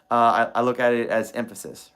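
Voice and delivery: normal talking voice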